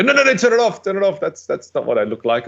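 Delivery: high, panicked no-no-no at own video; mock panic, begging to turn it off; mock horrified viewer. Person seeing themself on video, in mock panic. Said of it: "they go".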